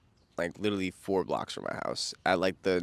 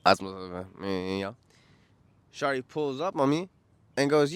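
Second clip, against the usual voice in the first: normal talking voice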